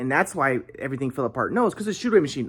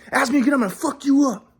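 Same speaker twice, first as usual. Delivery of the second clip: raised-pitch mock angry threat; threatening dad; 9 semitones higher, 3 dB louder than usual. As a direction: imitate a father losing his temper. Retold dad threatening his son.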